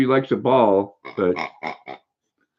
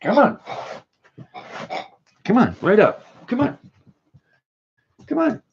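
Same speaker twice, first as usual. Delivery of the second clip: much higher sing-song come on; coaxing a pet, pitched way up; high calling voice; 9 semitones higher than usual. Real, not acted. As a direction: call the dog over cheerfully. Calling the dog over.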